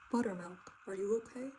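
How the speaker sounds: gently voiced papa calling through the dark; father checking on his scared daughter, lightly low; low, foggy voice. A narrator's reading. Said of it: "in his low, foggy voice".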